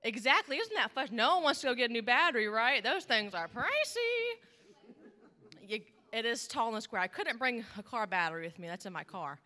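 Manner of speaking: usual speaking voice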